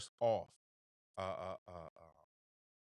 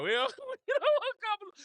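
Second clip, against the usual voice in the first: very high, broken up by laughter; laughing; 3 dB louder than usual. Real, not acted. Voicing sorority women refusing Kamala, laughing. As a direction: laugh through the objection.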